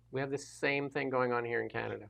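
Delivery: quiet echo. Real, not acted. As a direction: drop the volume and echo it. Repeats the point twice.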